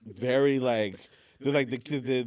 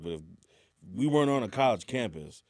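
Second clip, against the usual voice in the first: ordinary delivery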